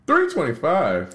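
high, slow disbelieving repeat of the price; can't believe how cheap it is; stunned by a bargain fare; surprised echo. Real, not acted. Host hears the cheap fare price.

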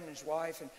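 ordinary talk

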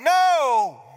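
loud high blurted no; loud sheepish no. Retold or imitated. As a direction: blurt the admission. Kids admit failure.

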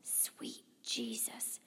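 slow, stunned whisper; awed whisper. A narrator's reading. Dad seeing the hovering sphere, stunned whisper. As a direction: whisper it very slowly, barely any voice. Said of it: "Dad whispers".

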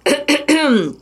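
hard throat clear. Real, not acted. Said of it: "Ai, desculpa".

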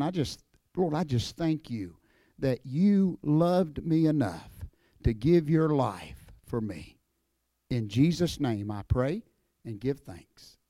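earnest, slowing prayer that fades to a hush at the end; closing prayer. Acted. Old wrestler praying over the church crowd, sincere and hushed.

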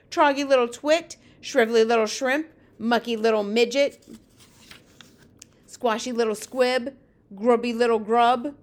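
loud, punched giant name-calling; shouted insults. Acted. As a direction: hurl each insult as a separate loud jeer. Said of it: "they shouted".

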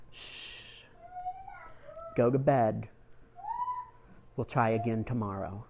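soft hushing mother, gentle and low; hushed comfort. Retold or imitated. Mother hushing her tearful son at bedtime, soft and tender. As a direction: soothe a crying child to sleep. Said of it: "She said".

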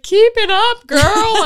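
high, loud cheering call; mock cheer. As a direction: yell it like a cheerleader egging her on. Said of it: "but I was like".

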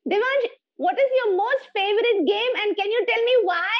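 sustained high shrill questioning; loud, shrill voice. Shown as a demonstration. Host asking about a favorite game, very high and shrill. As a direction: hold the shrill pitch through the whole question. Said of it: "continue a conversation in a loud and a shrill voice".

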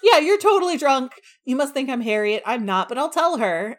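mock outraged Emma. Emma scoffs at drunk Mister Elton, mock loud. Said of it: "And he's like".

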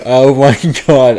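breaking into laughter mid-phrase; laughing. Real, not acted. Co-host reacting to the vomiting story, laughing. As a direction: laugh through the words.

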